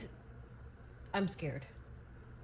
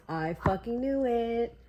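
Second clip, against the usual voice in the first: ordinary delivery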